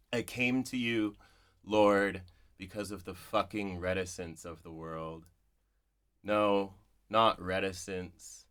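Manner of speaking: slow, measured poem reading with long pauses. Shown as a demonstration. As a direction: read it like a prayer, letting each line hang. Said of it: "this is the poem in its entirety".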